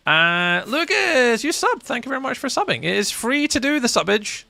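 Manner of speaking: high-pitched gushing sub shout-out; excited thanks. Real, not acted. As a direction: thank the new subscriber in a high, playful voice. Thanks a new subscriber.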